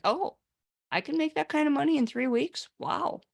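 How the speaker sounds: high-pitched, wowed reaction; impressed customer. Acted. Would-be customer reads an income claim, amazed. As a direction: react in wide-eyed wonder, pitch lifted.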